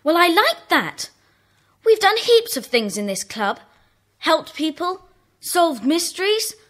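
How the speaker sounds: loud indignant protest; stung and protesting loudly. Acted. Indignant outburst in club.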